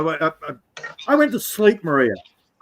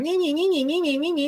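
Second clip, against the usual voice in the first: much higher, nasal-free squeaky nee-nee; mocking girls' chatter; 11 semitones higher, 4 dB quieter, faster than usual. Mocking the girls' stream chatter with high squeaky nonsense. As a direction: chirp it like yapping girls. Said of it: "all the others are going".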